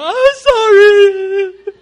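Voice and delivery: high, loud laughing yelp of pain; mock pained cry. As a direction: yelp like a spanked kid, hamming it. Kid cries after belt.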